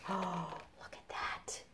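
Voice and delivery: hushed whisper of wonder; awed whisper. Pretend. Reader showing children the picture, awed whisper. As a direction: breathe it out in a whisper.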